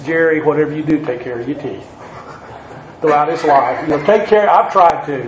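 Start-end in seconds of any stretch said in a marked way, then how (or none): 0.0-1.9 lightly voiced granddaddy, lower; a hint of a low-voiced grandfather. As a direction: quote grandfatherly advice, softening at the end. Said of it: "one of the things my granddaddy told me".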